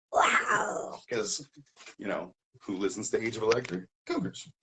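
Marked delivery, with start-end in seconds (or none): 0.1-1.0 loud, rough mock cat yowl; playful big-cat snarl; cougar growl. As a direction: let out a jokey cougar roar. Mimics cougar after "Cougar Night.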